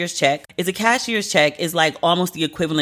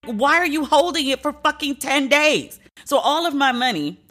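exasperated outrage; 8 semitones higher than usual. Real, not acted. Ranting about held cashier's check.

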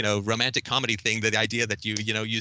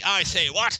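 high, loud, mocking upper class British "I say what?"; hammed-up posh British accent, much higher and louder; 9 semitones higher, 3 dB louder, slower than usual. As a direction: lampoon a toffee-nosed English aristocrat. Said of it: "upper class British accent".

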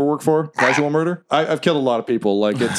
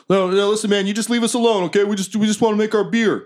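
a higher, pleading dwarf worker; 6 semitones higher than usual. Acted. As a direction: beg the intruders to leave you be.

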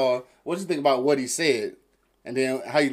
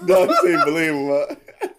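laughing; 4 semitones higher, 6 dB louder, slower than usual. Real, not acted. Laughing at the boast.

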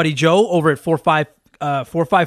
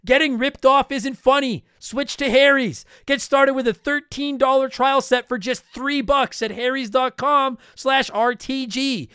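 upbeat sponsor read, pitched higher; higher, brighter host-read commercial; 6 semitones higher than usual. Acted. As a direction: sell it like a podcast ad spot.

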